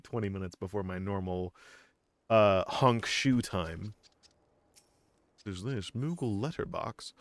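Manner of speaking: ordinary talk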